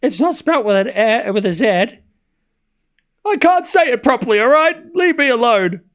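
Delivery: high-pitched, hammed-up tearful protest; mock tears. Commentator pleading with chat over the accent, mock-crying. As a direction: fake a tearful meltdown, pitch climbing high. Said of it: "we'll save those tears".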